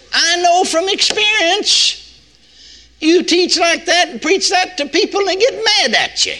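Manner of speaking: full-force preaching. Acted. Preacher warning the congregation about angry listeners, impassioned. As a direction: lift it up and preach it hard.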